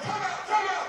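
hollering for a timeout from the sideline. Retold or imitated. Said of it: "I'm hollering".

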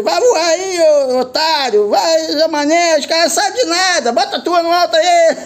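high, mock yelling taunt; high jeering kite heckle; son yelling. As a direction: mimic a son heckling kites. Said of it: "meu filho gritando com ele".

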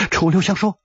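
usual speaking voice

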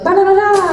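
high, loud nonsense syllables; imitated wide-open babble. Showing how animated mouth flaps run, high loud babble. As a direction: belt out gibberish on an open mouth. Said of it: "they'll be like".

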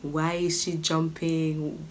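usual speaking voice

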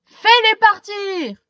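high, loud desperate yell. A narrator's reading. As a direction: shout at the boy to get rid of them. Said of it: "hurle le policier".

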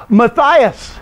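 slow Matthias, long "eye" in the middle; Greek pronunciation. Shown as a demonstration. Teacher summing up the vowel rule, Matthias said slowly with a long I. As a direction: draw out Matthias as muh-THIGH-us. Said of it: "here the I's are pronounced as I's".

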